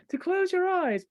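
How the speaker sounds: normal talking voice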